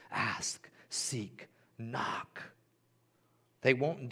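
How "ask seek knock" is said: softer, whispered words; hushed whisper. Shown as a demonstration. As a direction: whisper each word slowly. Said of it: "preacher repeats the verse".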